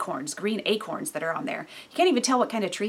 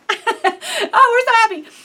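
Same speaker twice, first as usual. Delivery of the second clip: much higher, louder, laughing fake cheer; mock happy family; 14 semitones higher, 11 dB louder, slower than usual. Mocking a posed family photo with laughing fake cheer. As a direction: ham up the fake smile for the camera. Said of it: "and then it's like".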